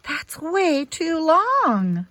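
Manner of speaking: a doctor frowning at a too-slow plan, slow and stern. A narrator's reading. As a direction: stretch it out like a worried doctor shaking his head. Frowning doctor voiced in story.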